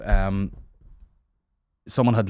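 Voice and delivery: ordinary talk, nothing marked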